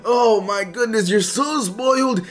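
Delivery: high, whiny mock complaint; nagging fan whine; whiny viewer voice. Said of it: "I know what you guys are all thinking".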